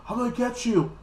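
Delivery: high, hammed-up villain threat; mock threat. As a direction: taunt them in a high voice. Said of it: "like, this".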